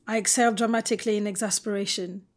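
usual speaking voice

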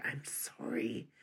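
soft slow whispered apology; whispered sorry to a friend. A narrator's reading. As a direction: breathe the apology close to her. Apologising close to her friend.

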